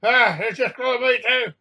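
pained outcry. A narrator's reading. Passenger just clawed by the cat, pained outcry. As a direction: cry out as the claws catch you.

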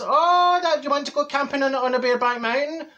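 high incredulous oh, then teasing; teasing the neighbour about his camping film, in mock shock. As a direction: pretend to be scandalised. Mocking neighbour's film choice.

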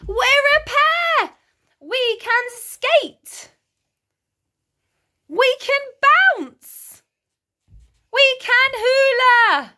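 very high, drawn-out delighted calls; excited cry. A narrator's reading. Simon telling a new friend they're a pair, excited cry. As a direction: call each one out high and gleeful. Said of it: "he cried".

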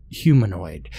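plain reading voice

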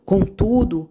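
ordinary delivery, nothing marked

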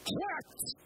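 "Poet." cried out very high as Hwæt; ringing bardic Hwæt; Old English cry. Shown as a demonstration. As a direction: summon the listeners like a bard. Beowulf opening again.

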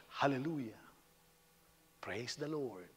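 soft, mock-solemn religious voice; pious churchgoer. Religious person coming along to greet, mock-pious softness. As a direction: intone it piously and gently. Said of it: "religious person by how he talks".

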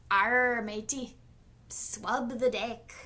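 hammed-up pirate accent, slow deck order. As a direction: growl it like a movie pirate accent. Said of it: "I don't know what that accent is".

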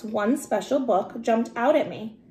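ordinary delivery

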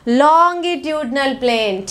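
"Longitudinal plane" stretched slowly for the class; drawn-out prompting. Shown as a demonstration. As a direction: draw out each word of "Longitudinal plane". Teacher prompts class to repeat term.

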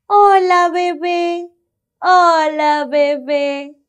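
put-on high, bright greeting to the baby; excited hello. Greeting the baby for the children, high and excited. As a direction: call out hello, high and loud.

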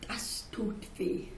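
slow, breathless whisper of hurt; old woman whispers her hurt to the child; whispered pain. Acted. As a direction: hush it out as if wounded. Old woman pained after crying out.

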